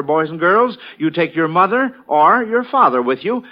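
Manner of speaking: ordinary talk, nothing marked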